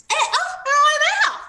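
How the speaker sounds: very high loud yelping cry; hurt T-Rex squealing; panicked dinosaur. A narrator's reading. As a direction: yelp out in panic.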